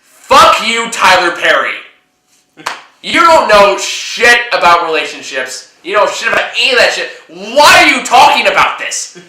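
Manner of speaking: loud angry outburst at Tyler Perry; angry rant. Real, not acted. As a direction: yell it right at him. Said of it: "Tone it down a little bit".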